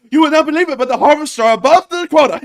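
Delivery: high, loud state TV announcer voice; north korean newsreader. Retold or imitated. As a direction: proclaim it like a propaganda news anchor. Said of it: "like the North Korean news lady going".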